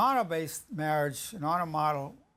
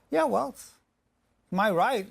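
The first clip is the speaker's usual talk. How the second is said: high, pushy and defensive; mock-indignant spouse insisting on rights; defiant; 6 semitones higher, 3 dB louder than usual. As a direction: throw it back at the wife, indignant. Spouse defends going out to lunch.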